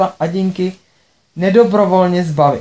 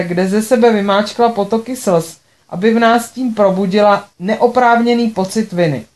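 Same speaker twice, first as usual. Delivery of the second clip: usual speaking voice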